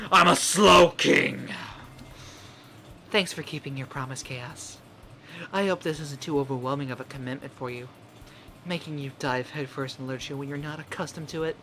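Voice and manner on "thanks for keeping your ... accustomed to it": put-on soft, low girl voice; quietly, politely as Monika. Voicing Monika.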